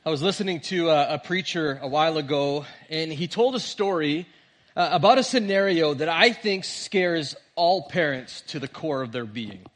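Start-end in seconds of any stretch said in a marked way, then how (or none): none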